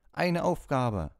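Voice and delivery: put-on high, slow, loud exclamation of an overjoyed old knight; a hammed-up knight, booming with delight; eager knight. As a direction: draw it out like a knight thrilled by a quest. Knight seizes on a quest.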